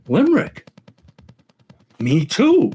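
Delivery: high, punched-up pub excitement; excited joke drinkers. Acted. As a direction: act thrilled at the coincidence.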